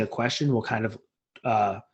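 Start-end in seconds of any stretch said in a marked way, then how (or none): none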